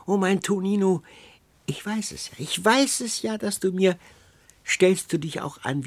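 high, loud crone's outpouring; rapturous old woman. A narrator's reading. Old woman exclaims to her beloved Tonino, rapturous.

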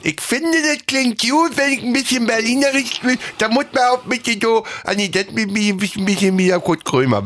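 high lisping Berlin voice; slushy lisp. Pretend. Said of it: "lispeln ist ja so".

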